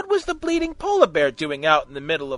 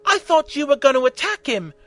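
female voice; 10 semitones higher, 5 dB louder than usual. A narrator's reading. Rose speaks.

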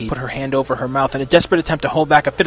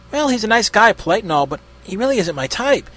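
a touch embarrassed, higher-pitched; embarrassed mumble; 6 semitones higher than usual. A narrator's reading. Maggie explains Andrew to Jazz, mildly embarrassed. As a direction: awkwardly excuse yourself, lightly voiced. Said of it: "she said in an embarrassed mumble".

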